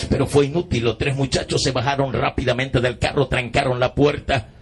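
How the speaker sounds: usual speaking voice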